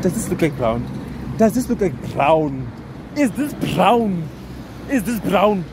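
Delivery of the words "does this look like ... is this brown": slow, high baffled questions, a bit louder; baffled disbelief. Real, not acted. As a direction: drag out each word in disbelief. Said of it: "I was baffled".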